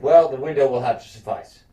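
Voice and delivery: a hint of a stern queen; lightly voiced prim dismissal; haughty quote. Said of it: "She's like".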